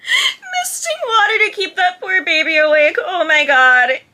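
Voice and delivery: high, loud, cracking up; laughing disbelief. Real, not acted. Viewer reacting to misting a poor baby awake, laughing loudly. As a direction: exclaim it through helpless laughter, voice shooting up.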